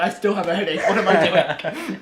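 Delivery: mock despair through laughter; laughing exasperation. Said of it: "I'm like".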